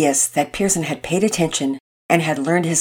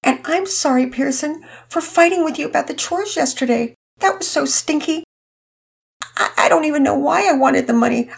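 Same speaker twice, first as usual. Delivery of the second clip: put-on girl's voice much higher, tearful and sweet; sweet through tears; 9 semitones higher than usual. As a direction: apologize sweetly while crying. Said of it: "said sweetly through her own tears".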